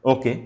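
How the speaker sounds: "ओके" said short and quick; short, matter-of-fact "ओके"; tone demo. Shown as a demonstration. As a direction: cut "ओके" brief, no lingering. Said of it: "आप feeling कैसे".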